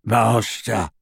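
grudging elf croak; bullfrog croak. A narrator's reading. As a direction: croak it like a sullen old elf. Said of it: "croaked Kreacher in his bullfrog voice".

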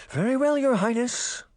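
high-pitched, anxious servant; worried. A narrator's reading. Worried merman gives in to the princess, high and anxious. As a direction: give in nervously in a high voice. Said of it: "the merman said with a worried face".

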